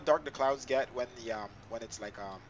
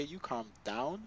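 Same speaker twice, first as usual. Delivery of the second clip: ordinary talk, nothing marked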